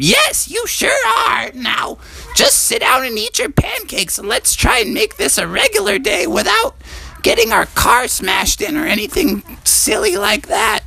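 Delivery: very high squeaky mother voice; mom voice. Acted. Storyteller voicing Froggy's mom scolding him, squeaky high. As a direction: play Mom up high and exasperated.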